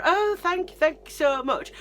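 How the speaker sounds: high, loud, gushing imitation of mum; fawning. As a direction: gush exaggerated thanks. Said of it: "My mum's fawning all over".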